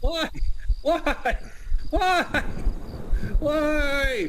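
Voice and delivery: high, loud frightened cries; panicked why. Real, not acted. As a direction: squeal in panic. Jump scare in horror game.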